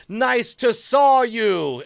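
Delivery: slow, high action-hero pun; hammed-up one-liner. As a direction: draw out the pun before charging in. Pun one-liner before chainsaw charge.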